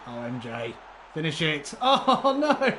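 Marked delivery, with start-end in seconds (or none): 1.8-2.7 much higher, louder, laughing cry of dismay. Real, not acted. Watching a striker miss the finish, laughing dismay. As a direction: groan in delight at the miss.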